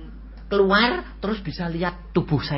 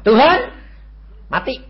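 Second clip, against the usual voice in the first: high, loud cry of Tuhan; man announcing his own death to Jesus, excited; excited call to God; 13 semitones higher, 9 dB louder than usual. Retold or imitated. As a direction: call out to the Lord, thrilled. Telling Jesus he died.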